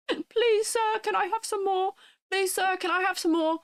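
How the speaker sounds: put-on high, pleading British urchin accent; British orphan. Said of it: "the British orphan stereotype".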